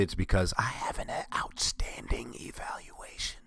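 a hushed, breathy whisper of a confession; whispered aside. Real, not acted. Admitting why he didn't call out, whispered. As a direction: whisper it like a little secret.

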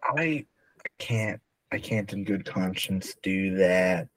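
normal talking voice

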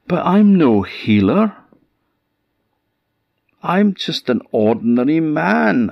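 high, pleading and alarmed; panicked protest. Acted. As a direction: beg them to believe you, voice jumping up. Donald protests he is no healer.

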